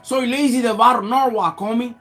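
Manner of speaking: high, relentless re-enacted rant; fired-up tirade. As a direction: lay out your hard life as a challenge. Retold tirade at the pastor.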